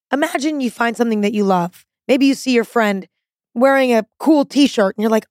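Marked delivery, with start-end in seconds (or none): none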